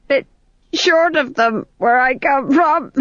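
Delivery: high, slow tipsy quip; drunk fool. Acted. The fool joking about dwarfs, high and tipsy. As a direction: giggle out the joke drunkenly.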